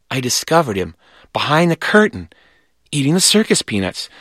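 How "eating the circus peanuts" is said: usual speaking voice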